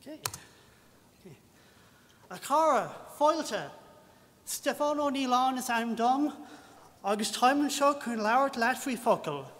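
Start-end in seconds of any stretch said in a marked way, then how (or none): 2.3-9.5 rapid foreign-sounding greeting in Irish; a self-introduction in clumsy Irish; mangled Irish greeting. Shown as a demonstration. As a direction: rattle off a greeting in halting Irish. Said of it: "Or in English".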